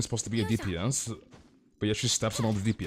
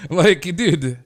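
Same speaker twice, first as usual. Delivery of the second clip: laughing; 5 semitones higher, 8 dB louder than usual. Real, not acted. Laughing at dumb comments.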